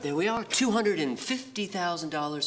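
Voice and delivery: ordinary delivery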